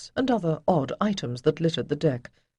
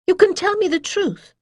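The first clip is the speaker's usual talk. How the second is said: higher, louder, firm demand; insist, pressing on YOU; insistent and sharp; 7 dB louder than usual. A narrator's reading. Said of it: "a little sharply".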